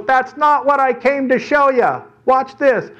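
high, drawn-out daredevil voice; boy showing off. Acted. Daredevil boy announcing his stunt.

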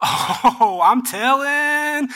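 high, drawn-out sing-song tattle; mock tattling. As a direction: play a childish snitch, stretching the words way out. Acted tattletales running to the Pharisees.

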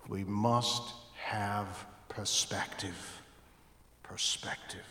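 slow, soft, nearly whispered perspective; hushed emphasis. Real, not acted. Pastor drawing the congregation in, softly. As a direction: drop to an intimate hush.

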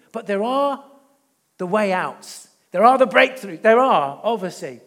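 loud, driving preacher's build; preaching loudly. Real, not acted. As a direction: press the point home with a loud, preaching push. Preacher rising to a climax.

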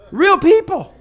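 loud, high-pitched preacher's exclamation; sudden high burst of emphasis; emphatic insistence. Real, not acted. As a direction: drive the point home at the top of the range. Repeats the point emphatically for contrast.

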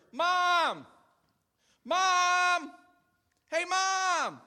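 high, loud repeated calls; lost kid calling out for his mother; child calling Mom. Retold or imitated. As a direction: yell for mom across the store. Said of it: "There is a beckoning call".

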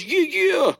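put-on high little-girl yeah yeah; toddler cheer. Dad imitating his daughter copying his cheer, high. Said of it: "And she goes".

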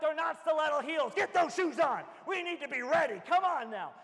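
loud, high scolding shout; exasperated parent. Retold or imitated. Dad scolding kids who have no shoes on, a loud high shout. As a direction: scold them loudly. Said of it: "I'm like".

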